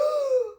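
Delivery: loud, high acted gasp; horrified gasp. Gerald realises about his friend, horrified gasp. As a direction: gasp at the awful thought.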